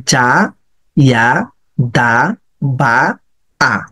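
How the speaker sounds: জা, ইয়া, দা, বা, আ each drawn out, pauses between; slow syllable-by-syllable. Shown as a demonstration. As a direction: sound out every letter on its own. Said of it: "এভাবে দুই তিনবার পড়ে". (ধীরে ধীরে আলাদা আলাদা করে)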